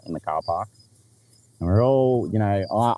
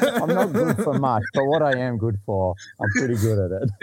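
higher mock-humble brag, lightly voiced; imitating Ricky; 6 semitones higher than usual. Narrator repeating Ricky's line to tease him, higher mock voice. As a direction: echo the boast in a pitched-up tease. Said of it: "he goes".

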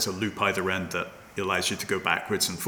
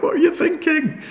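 incredulous; 7 dB louder than usual. Retold or imitated. Exasperated quote to planners.

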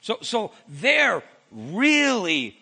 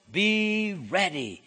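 "be ready" drawn out slowly, each word weighted; slow emphatic stress; much slower than usual. Shown as a demonstration. Preacher driving the point home to the congregation, slow and weighty. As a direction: plant both words firmly and slowly. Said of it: "that I want to drive home".

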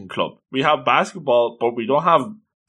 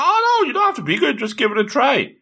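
high, brisk acted reassurance; pitched-up, peppy character coaxing; eager encouragement; 13 semitones higher than usual. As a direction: brush off their doubts in a high voice. Acted girl encouraging the reluctant boy.

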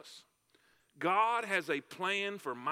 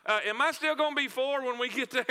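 put-on higher and louder, a small boy blurting it out; boy blurting his question to his mother; blurted child question; 6 semitones higher, 4 dB louder than usual. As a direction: play the four-year-old who can't hold it in. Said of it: "blurted out and said".

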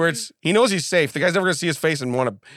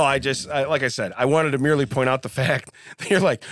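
usual speaking voice

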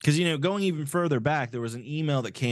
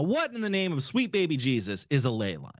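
ordinary delivery

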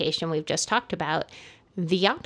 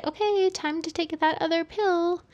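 nurse voice; 10 semitones higher than usual. Retold or imitated. Nurse quoted waking patient.

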